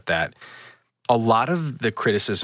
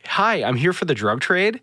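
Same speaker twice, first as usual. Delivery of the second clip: chirpy, higher-pitched greeting of an oblivious outsider; clueless rich newcomer; 9 semitones higher than usual. Acted. Ferrari driver greeting drug dealers, naively chirpy. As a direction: play someone breezily out of place. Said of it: "someone who shows up in a Ferrari".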